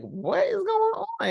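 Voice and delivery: very high, startled wife voice; alarmed wife. Retold or imitated. Wife catching her husband's outburst, high and startled.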